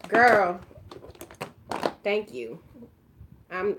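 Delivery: ordinary delivery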